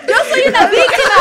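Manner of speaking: loud, high protest; indignant. Real, not acted. Accused of playing the victim, indignant. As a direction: protest loudly over the laughter. Defends herself amid teasing laughter.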